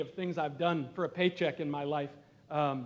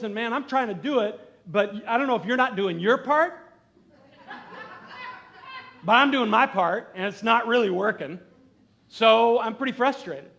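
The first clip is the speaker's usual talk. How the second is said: louder, higher pleading complaint to God; frustrated prayer; 5 semitones higher, 8 dB louder than usual. Retold or imitated. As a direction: vent your frustration at the Lord. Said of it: "I was frustrated".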